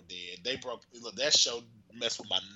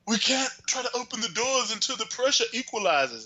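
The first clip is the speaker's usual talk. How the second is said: lightly voiced Robert; 9 semitones higher than usual. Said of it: "Robert was like".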